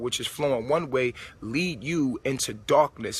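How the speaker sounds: ordinary talk, nothing marked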